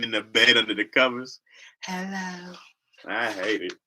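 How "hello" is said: soft, drowsy drawn-out hello; sleepy voice. Retold or imitated. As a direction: imitate someone just woken up. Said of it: "I hate the sleepy voice".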